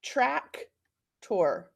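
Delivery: "Tractor" with a full "or" ending, TRAK-tor; "Tractor" broken into syllables, ending like "tore"; sounded-out tractor. Shown as a demonstration. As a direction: say "Tractor" in two separate parts, TRAK, then TOR. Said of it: "my short vowel".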